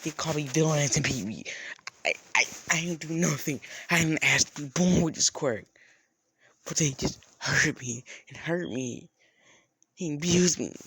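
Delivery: loud, stammering outburst; distressed protest. Acted. Abused girl pleading her case to a stranger, distressed and loud.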